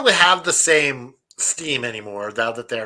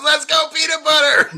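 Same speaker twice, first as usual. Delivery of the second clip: put-on much higher, loud excited kid cheer; Caleb; 13 semitones higher, 6 dB louder than usual. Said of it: "Caleb goes".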